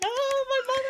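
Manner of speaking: very high-pitched mock squeal; imitated excited daughter. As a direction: squeal like a thrilled kid. Daughter's reaction when mom is picked.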